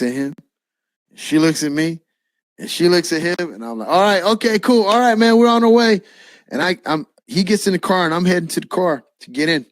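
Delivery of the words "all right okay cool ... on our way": high, hurried acted cheerfulness; retold awkward let's-get-going; rising, louder fake-casual chatter; flustered cover-up. Covering up awkwardly as friend appears.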